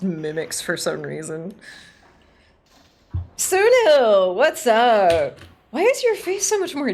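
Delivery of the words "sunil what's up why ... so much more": loud cheerful hail; loud greeting. Acted. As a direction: call out the name loud.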